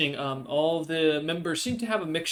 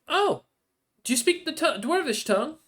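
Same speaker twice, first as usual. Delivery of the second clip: put-on high, louder young dwarf woman; sweet dwarvish barmaid; 14 semitones higher, 7 dB louder than usual. Barmaid greeting a newcomer at the bar, sweet and high. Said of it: "she smiles sweetly at you and goes".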